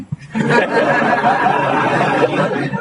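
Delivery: breathy high-pitched giggle; a long helpless giggle; high laugh. Real, not acted. Laughs at the story. (tawa cekikikan tinggi tanpa suara)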